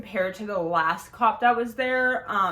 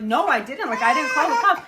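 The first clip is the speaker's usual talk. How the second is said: insistent denial; 6 semitones higher, 8 dB louder than usual. Retold or imitated. Denying to ex in quarrel.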